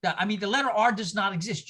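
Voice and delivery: normal talking voice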